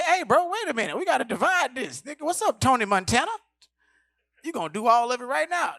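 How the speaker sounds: high, loud protest; alarmed scolding. Retold or imitated. Comic halting Cat snorting all lines, high loud protest. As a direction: stop him loudly in alarm.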